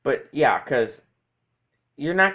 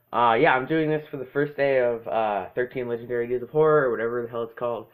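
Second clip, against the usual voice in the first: ordinary talk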